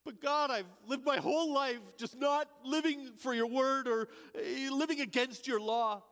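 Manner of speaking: high, urgent protest of guilt; pleading. Acted. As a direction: plead with rising desperation. Thief pleading with God.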